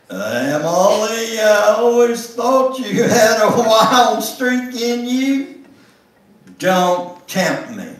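high, slow and loud, amused; teasing Victor. Acted. Conductor teasing Emily about her wild streak, high and amused. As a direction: play the conductor laughing at her nerve.

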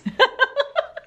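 high, breaking-up giggle. Real, not acted. Vlogger amused by her own accent, high giggle. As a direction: laugh helplessly at your own slip.

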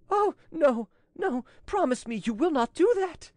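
high, loud desperate plea; begging in panic; crying out. Acted. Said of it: "cried she".

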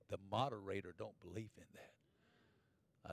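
slow, low and very soft quote; hushed objection. Retold or imitated. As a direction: imitate a solemn hushed warning. Retold hushed objection to clapping.

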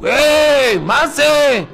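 sudden high shout, hey what's this; calling out. Retold or imitated. Waiting crowd calls out as the man leaves, loud surprised shout. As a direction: call out in surprise across the room.

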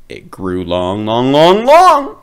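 long long long rising to a squeal; growing longer. A narrator's reading. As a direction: build up like something stretching. Nose grows longer and longer.

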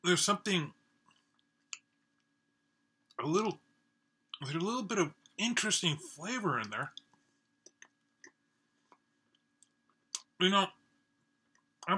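chewing between words; talking while eating; mouth partly full. Real, not acted. Seen 1: Takes a bite at -4.0; jaw keeps working while he talks.

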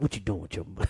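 Dick Gregory impression. Comedian retelling his elder grilling him about money, low and gruff. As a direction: drop way down and lean on him like a stern elder. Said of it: "He's like".

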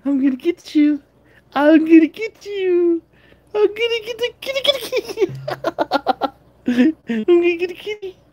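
high, sing-song pet voice; baby talk to cats. Acted. Owner cooing to her cats, high baby talk. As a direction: coo at the cat like a baby.

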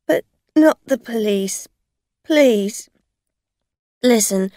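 loud, high desperate pleading; a frightened drunk woman begging; pleading driver. A narrator's reading. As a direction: beg her not to call the police.

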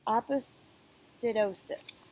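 Oppositosis drawn out slowly, every syllable clear; slow title word. Shown as a demonstration. Teacher announcing the chapter title Oppositosis, slow and careful. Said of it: "That's a made-up word".

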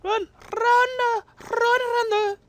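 high repeated run, run; panicked high run. Real, not acted. Escaping an enemy player, high panicked chant. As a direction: squeal it while fleeing. Fleeing in game.

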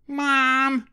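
high loud call; child crying Mom. Acted. Matilda cries out to mother after a pinch, high call. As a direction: call out for mum. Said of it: "Matilda cried loudly".

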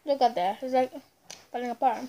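chewy muffled speech; talking while chewing. Real, not acted. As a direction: mouth partly full of cake. Seen 0: Bit cake before; lips pursed, jaw working while talking till ~4s.

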